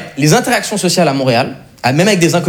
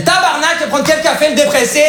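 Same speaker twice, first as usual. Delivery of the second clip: high, hammed-up Québécois accent; 12 semitones higher than usual. Waitress cutting off a moaning customer, hammed-up Québécois accent. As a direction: imitate an exasperated Québécois in a high voice. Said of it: "j'ai pris l'accent québécois".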